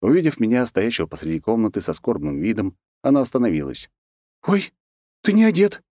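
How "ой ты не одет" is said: much higher, louder startled cry; shocked exclamation. Acted. Anna bursts in and sees him undressed, shocked cry. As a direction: gasp it out, flustered.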